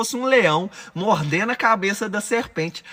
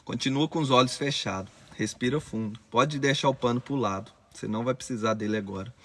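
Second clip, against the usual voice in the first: much lower and softer, calming voice; hushed meditation guide after the screaming; guided meditation; 3 semitones lower, 4 dB quieter than usual. Acted. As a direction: ease them down into stillness.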